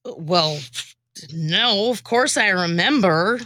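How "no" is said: usual speaking voice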